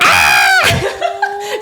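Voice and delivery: high laughing mock scream; a touch of a thrilled squeal; surprised shriek. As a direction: gasp out a delighted ah. Reacting to proposal.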